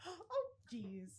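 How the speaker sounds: gasp, then a high, soft "oh, jeez"; mock fright, as if nearly dropping a child; playful fake-startled gasp and exclamation; pretend alarm. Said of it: "pretend to drop her and go".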